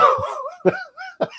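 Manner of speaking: high real laugh; real laughter. Real, not acted. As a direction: break into a laugh. Laughing at his own story.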